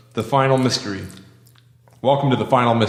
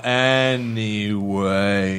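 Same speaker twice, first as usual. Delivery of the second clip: slow sung-out word; really slow; much slower than usual. Shown as a demonstration. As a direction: drag it out in a sing-song. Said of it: "let's talk really slow".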